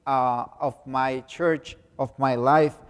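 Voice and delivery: ordinary talk, nothing marked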